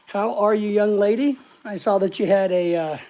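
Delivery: ordinary talk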